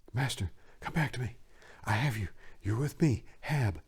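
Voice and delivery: soft, hushed and quick; reassuring whisper. Acted. Hab soothing his shattered master, a soft reassuring hush. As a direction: gently coax him back, close and quiet. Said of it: "whisper reassuringly to his troubled master".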